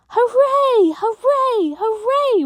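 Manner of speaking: high excited shrieks of joy; shrieking hooray. A narrator's reading. Children cheering the TV news, shrieking. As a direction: kids shrieking with delight. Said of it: "we shrieked".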